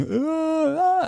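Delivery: high mock wail; retold wailing, sung up high; imitated wailing. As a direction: wail up high in a short cry. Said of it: "I started wailing".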